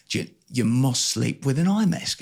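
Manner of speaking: put-on shaky stab at an Australian accent, sliding toward English; attempted Australian accent. Man imitating his Australian adviser on eye masks, attempted Australian accent. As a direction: imitate an Australian woman giving orders. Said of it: "she's Australian".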